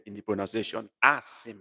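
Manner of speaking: usual speaking voice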